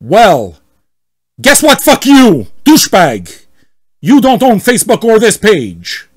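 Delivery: hammed-up yell at the admin; angry member shouting at the admin; mock furious yell. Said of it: "read as much as possible like him".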